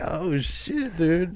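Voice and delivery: high, hushed "oh shit"; stunned. Retold or imitated. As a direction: gasp it out in disbelief. Meeting his lookalike, stunned.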